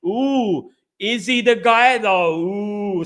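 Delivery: drawn-out, insinuating oohs; mock doubting. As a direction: stir up doubt with a slow, teasing question. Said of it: "to start saying".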